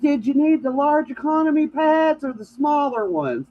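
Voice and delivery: mock dad hollering across the store. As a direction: call out across the shop. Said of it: "And he hollers".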